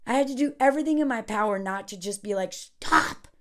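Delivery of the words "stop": retold yell. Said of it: "Not to yell at her".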